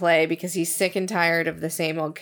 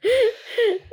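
sudden high laugh; high burst of laughter; 3 dB louder than usual. Real, not acted. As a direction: let out a squealing laugh. Laughing at hiccups.

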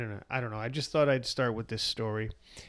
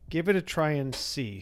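with a smile; 4 semitones higher, 6 dB louder than usual. Acted. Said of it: "said the sage with a smile".

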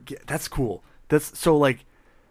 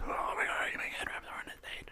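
whispering; 12 dB quieter, faster than usual. Acted. Excited whispered question.

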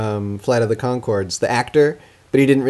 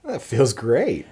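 blissful; much slower than usual. Acted. Sketch pleasure test reaction.

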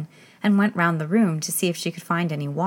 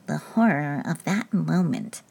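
slow, hushed dread; mildly frightened king. Acted. King to Queen after his fright, hushed dread. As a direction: recall it with a shudder. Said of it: "frightened whisper".

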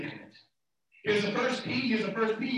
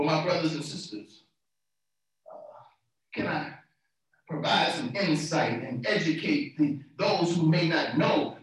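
normal talking voice

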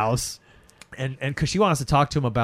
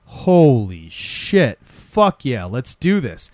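slow, loud "Holy shit" then a pumped yell; hyped band reaction; 5 dB louder, much slower than usual. Retold or imitated. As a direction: hype it up like a band hearing a hit. Band hears the song, hyped.

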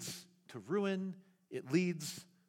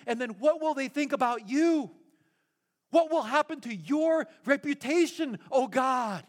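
higher, heated Moses pleading with God; paraphrased Moses; 5 semitones higher than usual. Retold or imitated. Moses pleading with God in the pastor's paraphrase, heated and insistent. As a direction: argue your case to God, worked up. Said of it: "what Moses was saying was".

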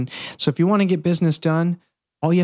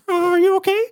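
much higher squeaky cartoon mouse, mock concern; Mickey Mouse; 3 dB louder than usual. Mickey Mouse checking on a kid in the studio, high squeaky voice. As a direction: peek in and fret in a cartoon squeak. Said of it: "Mickey Mouse is, like, peeking his head in".

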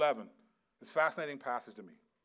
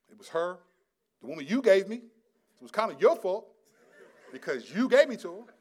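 blame-shifting excuse; 5 semitones higher, 10 dB louder than usual. Pretend. Adam shifting the blame to Eve and to God, defensive. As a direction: push the blame away indignantly. Adam blaming others, acted.

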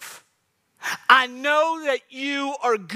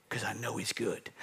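hushed whisper, much lower; whispered conviction; 11 semitones lower, 14 dB quieter than usual. Acted. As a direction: confide it softly to the listener.

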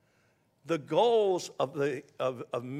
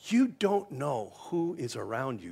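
usual speaking voice

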